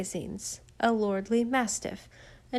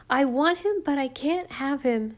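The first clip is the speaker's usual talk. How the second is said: sharp, higher and louder outburst, fading at the end; snapping boy; 5 semitones higher, 4 dB louder than usual. A narrator's reading. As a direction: snap it out, hurt and cornered. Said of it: "snapped Roddy".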